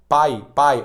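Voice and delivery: PAI said purely through the mouth; crisp demonstration of PAI, oral vowels only; oral diphthong. Shown as a demonstration. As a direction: repeat PAI with no sound in the nose. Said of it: "Tu não tá usando o nariz".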